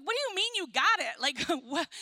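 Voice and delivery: high incredulous retort, then a laugh; can't believe he found it; disbelief. Retold or imitated. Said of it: "I'm like".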